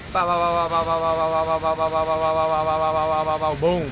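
loud rapid bow bow blasts; machine-gun noises with the mouth; gunfire noises. Shown as a demonstration.

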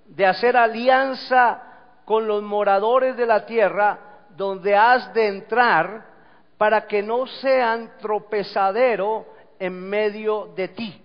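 loud, slow preacher proclaiming a verse; lectura proclamada de la Escritura. Acted. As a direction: declaim each phrase with force and pauses. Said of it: "Dice la Escritura". (proclamar la Escritura, fuerte y lento)

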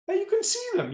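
high, mock astonished reply; mock surprise. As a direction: act amazed that they can see. Coach's mock surprised retort.

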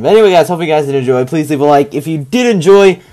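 upbeat, fast sign-off voice; youtuber outro. Acted. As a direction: pump up the goodbye to viewers.

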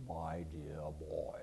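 very low, soft, slow senatorial rumble; Dirksen's mellifluous voice. Retold or imitated. Imitating the senator's famous voice for the audience, low and slow. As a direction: stretch "My dear boy" out deep and slow like an old senator. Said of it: "he always spoke like this".

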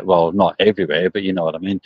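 ordinary delivery

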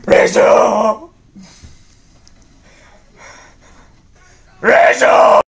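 calling out. Acted. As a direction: shout her name, rising. Calling out for Rachel.

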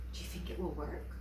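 ordinary delivery

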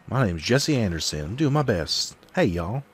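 put-on southern accent, pitched a bit high; swooping southern-accented character voice; Jesse Anderson. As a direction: introduce yourself with a southern twang. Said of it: "a southern accent".

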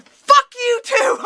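high, loud, drawn-out yell of outrage; explode, stretching the words high and loud; furious outburst. Retold or imitated. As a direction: blow up at him in incredulous rage. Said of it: "I just blew up".

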